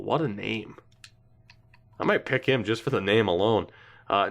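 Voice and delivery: ordinary talk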